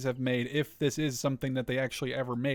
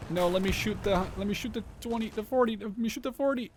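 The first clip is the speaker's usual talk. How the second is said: high-pitched, climbing, flustered insistence; urgent frustrated plea; 7 semitones higher than usual. Real, not acted. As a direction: plead urgently, tripping over the order. Panicked order as game units misbehave.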